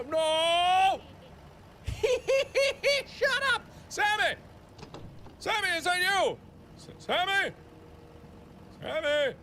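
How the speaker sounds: high, loud frantic shouting; frantically calling out for a lost friend Sammy; panicked calling. Real, not acted. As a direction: yell for Sammy in real fright.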